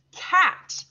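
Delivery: cat as one strong, high beat; one-syllable beat. Shown as a demonstration. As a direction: punch "Cat" as a single syllable. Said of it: "you'll clap for your one syllable".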